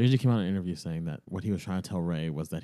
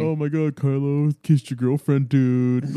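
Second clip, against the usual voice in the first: mocking; 4 semitones higher, 7 dB louder, slower than usual.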